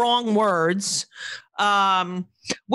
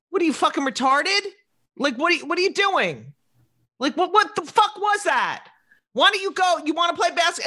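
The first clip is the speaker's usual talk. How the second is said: mock angry coach; 6 semitones higher than usual. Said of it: "there are coaches that are like".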